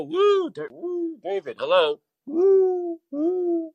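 high hooting call; calling from far away. Real, not acted. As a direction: call out woo into the void. Calling out to absent caller.

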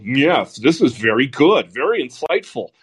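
swooping, self-satisfied mock praise; exaggerated approving singsong; mock self-approval. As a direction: imitate colleagues congratulating each other, pitch rising and dipping. Mocks self-satisfied news industry colleagues.

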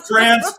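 "France" with short a, rhyming with "dance"; loud, laughing English "France", short a; anglicized pronunciation. Shown as a demonstration. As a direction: insist on "France" with the a of "cat". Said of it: "Stop pronouncing it".

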